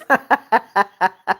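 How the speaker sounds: long, even string of chuckles; laughing. Real, not acted. Streamer amused by comment about slaying haters, laughing. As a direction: let out a sustained ha-ha-ha.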